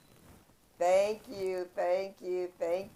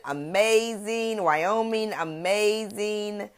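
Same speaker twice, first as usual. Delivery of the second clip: slow stretched amazing, louder; drawn-out amazing; 4 semitones higher, 8 dB louder, slower than usual. Real, not acted. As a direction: savor each amazing. Greeting viewers by city.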